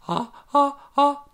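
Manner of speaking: high rising ah ah gasps; building pre-sneeze gasps. A narrator's reading. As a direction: gasp toward a sneeze. Woman about to sneeze.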